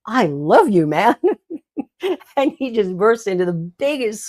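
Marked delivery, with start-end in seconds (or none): 0.1-1.2 loud, beaming line breaking into laughter; laughing declaration. Retold or imitated. To the man reading The Godfather, loud and laughing. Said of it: "And I went". 1.2-2.3 high delighted laugh; high burst of laughter. Real, not acted. Telling the congregation the story, a high delighted laugh. Laughs after her joke.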